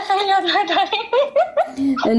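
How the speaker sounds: slow, laughing self-deprecation. Real, not acted. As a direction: laugh it off slowly. Caller laughs about forgetting songs.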